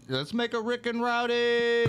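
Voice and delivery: slow, high show host voice; bartender show host voice. Retold or imitated. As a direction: announce it like a TV host. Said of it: "I said".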